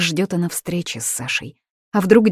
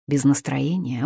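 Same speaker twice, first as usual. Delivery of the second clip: ordinary delivery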